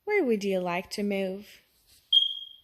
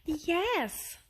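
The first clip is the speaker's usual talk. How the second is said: happily; 8 semitones higher, 5 dB quieter than usual. A narrator's reading. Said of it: "said Judy happily".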